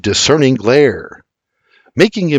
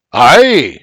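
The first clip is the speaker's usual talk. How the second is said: a bright Scottish aye, louder; 3 semitones higher, 6 dB louder than usual. Acted. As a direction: nod it out in a brogue. Old Scot nods smiling.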